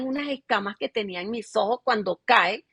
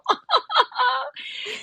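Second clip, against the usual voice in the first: a high burst of laughter after her words; real high laugh; 13 semitones higher than usual. Real, not acted. Woman laughing at her old stubborn self, high real laugh.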